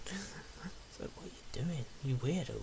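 very soft whisper; whispering like a weirdo. Real, not acted. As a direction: whisper it as a silly aside. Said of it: "because I was whispering like a weirdo".

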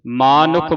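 ਮਾਨੁਖ as maa-nukh; long first vowel. Shown as a demonstration. Teacher corrects the misreading, ਮਾਨੁਖ with long aa. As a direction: hold the aa after m in ਮਾਨੁਖ. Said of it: "ਮਾਨੁਖ ਬੋਲਣਾ ਹੈ".

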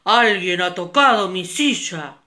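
loud big-bear shout; papa bear shouting loud. Acted. Papa bear finds his chair touched, loud shout. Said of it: "gritó muy fuerte".

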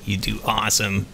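a lumbering golem, gently besotted; deep creature voice. Acted. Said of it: "He goes".

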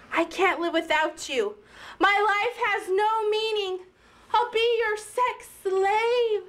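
melodramatic begging, pitch climbing; desperate pleading. Acted. Actress begging her lover to stay, desperate melodrama. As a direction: plead like an overwrought soap heroine.

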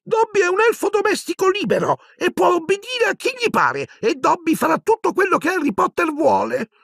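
very high, emotional elf voice; Dobby, sobbing. Acted. Free house-elf pledges himself to Harry, tearfully squeaking. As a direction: pipe up in tearful devotion. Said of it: "singhiozzò Dobby".

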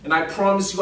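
ordinary delivery

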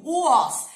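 "was" loud and high, opening on a big "u"; sound demo. Shown as a demonstration. Teacher correcting students, "was" loudly stretched from a U sound. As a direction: exaggerate the "oo" glide that starts "was". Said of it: "isso aqui tem som de U".

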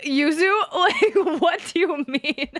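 retold disbelief, broken up by laughter; laughing in her face. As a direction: laugh incredulously as you question her. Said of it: "I, like, laughed in her face".

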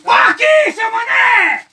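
loud, high cry of alarm; alarmed shout. Acted. Frightened digger shows his mate the spot, shouting high. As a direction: shout urgently for his attention.

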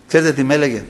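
ordinary talk, nothing marked

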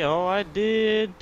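high, loud, drawn-out cheer; excited yell. Real, not acted. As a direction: savor the win out loud. Set up a play, it worked.

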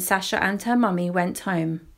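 ordinary delivery, nothing marked

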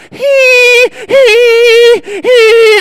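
high strangled wheezing gasps after a throat punch; hammed-up strangled hee hee hee; choking wheeze. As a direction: gasp for air in short squeaks like a choked man. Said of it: "Your body makes noises that don't seem".

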